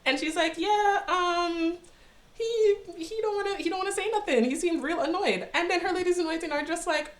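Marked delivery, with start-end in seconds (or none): 0.6-5.5 acted reluctant admission, pitched high; hesitant report. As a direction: stall uneasily in a much higher voice. Empress hesitantly reporting to her ladies.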